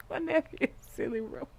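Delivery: ordinary talk, nothing marked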